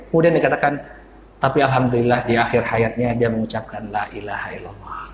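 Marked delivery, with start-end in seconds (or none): 1.4-5.0 very soft, low, near-whispered retelling; hushed reported news. Retold or imitated. A son reporting his late father's last words, hushed.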